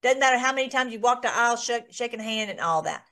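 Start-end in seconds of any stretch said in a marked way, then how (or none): none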